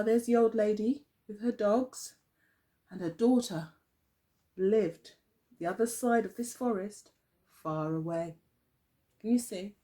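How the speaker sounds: ordinary delivery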